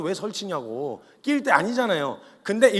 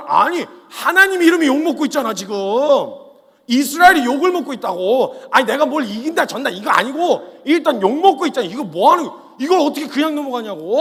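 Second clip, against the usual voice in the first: very loud, higher, shouted indignation; furious protest; 7 semitones higher, 10 dB louder than usual. Acted. David protesting to the soldiers, shouting in fury. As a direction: shout it in righteous rage.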